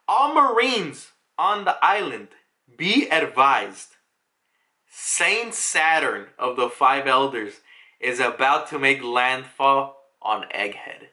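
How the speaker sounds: slow, spaced-out broadcast delivery; official announcement. Retold or imitated. Vice admiral warns all Marines of Saint Saturn, slow announcement. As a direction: proclaim it gravely, phrase by phrase. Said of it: "we get an announcement".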